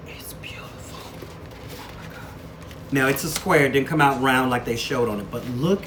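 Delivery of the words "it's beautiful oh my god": breathless whispered awe, slow and much softer; real hushed amazement; awed whisper. Real, not acted. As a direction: whisper it like you can't believe how pretty it is. Awed at unwrapped sticker.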